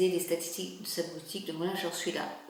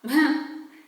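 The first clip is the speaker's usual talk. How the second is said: short real laugh; 5 semitones higher, 8 dB louder than usual. Real, not acted. Laughing on the phone.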